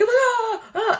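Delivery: very high, short panicked yelps; hammed-up freak-out yelp; mock panicked cry. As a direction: squeal like you're freaking out. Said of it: "I'm here freaking out".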